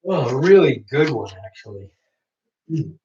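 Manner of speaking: talking through a yawn. Real, not acted. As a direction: yawn while saying it. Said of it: "You yawning?".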